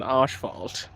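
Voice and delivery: lightly mock-German asphalt, "AHSH-falt"; a hint of German in the open first vowel; German accent. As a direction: play up a German "asphalt", softly. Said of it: "Sounds very German".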